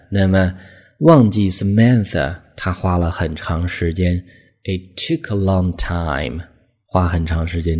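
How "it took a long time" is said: "It took a long time" slow with a rise on "long"; slow model read. Shown as a demonstration. Teacher repeating the phrase for learners. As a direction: draw out "long". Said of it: "很地道的表达".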